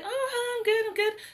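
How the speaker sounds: very high, light re-enacted greeting; chirpy small talk. Actor answering casting on entering the room, chirpy mock small talk. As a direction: play up the sweet, high-pitched polite reply.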